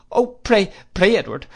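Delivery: high, loud, distressed plea; crying out. A narrator's reading. Mother interrupts her husband, crying out imploringly. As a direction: beg him to stop. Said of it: "cried my mother".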